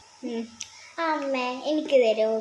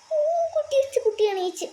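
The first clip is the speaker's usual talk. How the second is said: dismayed cry; 12 semitones higher than usual. Acted. Alarm that the baby woke.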